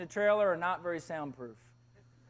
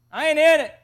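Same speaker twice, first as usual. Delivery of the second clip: louder, higher insistent disclaimer; emphatic refusal; 6 semitones higher, 10 dB louder than usual. Retold or imitated. Boy washing his hands of his brother's secret, emphatic. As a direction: push it out loudly, wanting no part of it.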